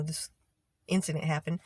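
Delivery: usual speaking voice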